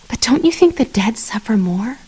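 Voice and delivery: ordinary delivery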